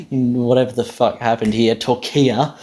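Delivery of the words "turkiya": Turkiya as "tor-KEE-uh"; wrong English pronunciation. Shown as a demonstration. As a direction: stress the middle of Turkiya, ending in "uh". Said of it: "the stressed syllable is different".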